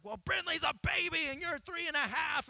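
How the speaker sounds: high, loud mock-exasperated dad; exasperated scolding. Dad retelling scolding his three-year-old, mock exasperated shout. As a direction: bark at a defiant toddler with mock outrage.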